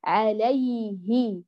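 "عليه" very slow, ay running unbroken up to the haa; long drawn "عليه", falling at the end; flowing soft yaa. Shown as a demonstration. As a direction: let the yaa of "عليه" glide until hi. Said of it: "اجعليه يجري إلى وصولك للهاء".

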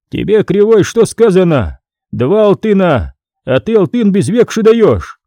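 irritated official barking at a debtor; stern scribe. Acted. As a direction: scold him for short payment. Scribe scolds the peasant.

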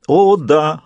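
hammed-up anguish; fake stifled sob. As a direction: pretend to choke back phony tears. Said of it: "фальшиво изображая, как давит фальшивое же рыдание".

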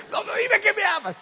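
very high, loud, laughing plea; hammed-up girlfriend. Girlfriend insisting to boyfriend, high laughing whine.

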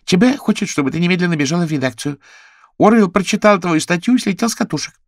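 very high, quick chatter; high hummingbird voice. Acted. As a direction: squeak it out like a tiny bird. (высокий щебечущий голос колибри)

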